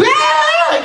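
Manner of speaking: Ed-style high yelp. Shown as a demonstration. Actor showing the panel the high Ed yelp he avoids. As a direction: shoot up into a falsetto ah. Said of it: "instead of".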